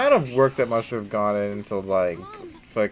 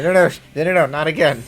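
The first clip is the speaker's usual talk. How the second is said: panicked loud pleading; 7 semitones higher, 11 dB louder, faster than usual. Retold or imitated. Acting panicked character reaction.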